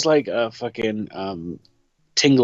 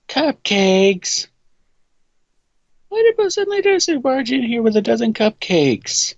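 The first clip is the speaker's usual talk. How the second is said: high, incredulous outburst; acted flustered astonishment; exasperated disbelief; 11 semitones higher than usual. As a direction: ask it baffled, pitched far above normal. Voicing exasperated character from script.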